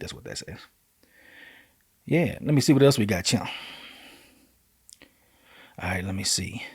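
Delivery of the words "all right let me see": whispered, muttering to himself. Real, not acted. Host alone with a stuck screen, whispering to himself. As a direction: breathe it under your breath.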